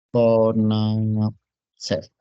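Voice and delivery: very slow, stretched syllables; drawn-out dictation. Real, not acted. Teacher writing the acronym for the class, drawn-out dictation. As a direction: sound it out while writing it.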